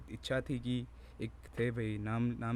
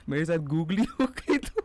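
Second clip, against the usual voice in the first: words breaking into giggles; higher, louder, laughing speech; 6 semitones higher, 5 dB louder than usual. Real, not acted. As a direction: talk through a fit of laughter. Laughing at his own slip.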